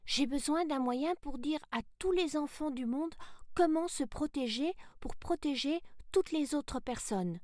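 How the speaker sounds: put-on high, eager girl's voice; young heroine Sarah, earnest. Sarah tells the voice what she needs, high and eager. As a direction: pitch it up like a keen little girl.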